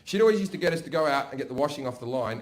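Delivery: ordinary talk, nothing marked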